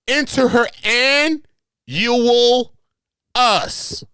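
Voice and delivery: high, very slow and loud; drawn-out high joke. Pretend. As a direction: stretch it out high for a laugh. Comic stretched word.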